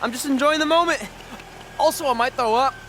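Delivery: high, giddy but sick; riding the high with a churning stomach; giddy and queasy. Acted. New champion giddy and nauseous after the match, high giddy voice.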